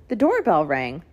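usual speaking voice

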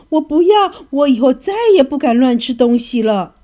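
high, loud frightened cry of refusal; shouting. A narrator's reading. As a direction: yell no, terrified of the pliers. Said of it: "他大喊着说". (小金鱼吓得大喊不要)

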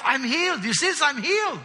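a lightly played, happy man showing off he can walk; excited healed man. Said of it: "I mean, he's excited".

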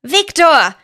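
loud high cry of the name; shouting. A narrator's reading. Lia jumping up and shouting at the villain. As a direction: yell his name as you leap up. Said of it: "schrie die aufspringende Lia".

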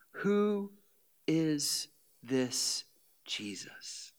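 soft whisper; whispered question. Retold or imitated. City people asking about Jesus, whispered. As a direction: breathe the question.

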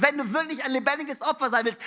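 usual speaking voice

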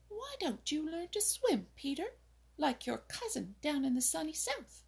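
a pert little wren pressing a question; demanding little bird. A narrator's reading. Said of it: "she demanded".

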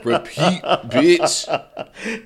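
playful hype shout. Real, not acted. Hyping each other, laughing.

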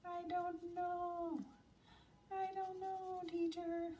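high, slow, drawn-out imitation of a pupil; pupils' answer voice. Pupils answering the teacher's question, high mock child voice. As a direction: put on a high, puzzled child's reply.

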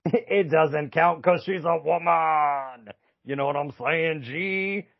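hammed-up mock argument; sassy France impression. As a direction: play France laying down the rule with attitude. Voicing France arguing against the claim.

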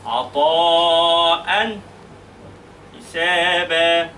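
عطاء حسابا drawn out very slowly with clear nunation; slow careful recitation; slow tajweed recitation. Shown as a demonstration. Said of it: "هقول إيه؟".

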